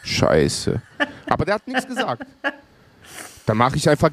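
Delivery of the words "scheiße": dropped low, muttered oh crap; a low unvoiced mutter of dismay; low mock groan. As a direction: voice the cop's silent dismay, deep and breathless. Cop's silent thought voiced.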